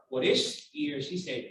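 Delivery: usual speaking voice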